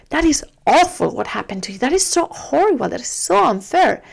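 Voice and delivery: over-the-top sympathetic outrage; exaggerated empathy. Shown as a demonstration. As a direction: ham up the outrage on the child's behalf. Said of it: "like, exaggerate".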